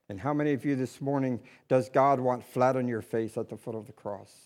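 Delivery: low, soft, intimate sermon voice; hushed preaching. Acted. Pastor speaking softly to the congregation about sacrifice.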